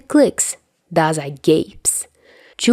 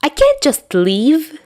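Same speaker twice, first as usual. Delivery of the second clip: high, slow protest, louder; 10 semitones higher, 4 dB louder, slower than usual. Acted. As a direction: dig in, refusing the idea. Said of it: "Dazai protests".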